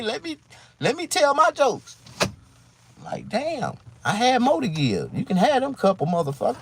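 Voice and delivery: high, loud pleading rant; exasperated plea. Retold or imitated. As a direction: beg to be taken along. Begging to be taken on tour.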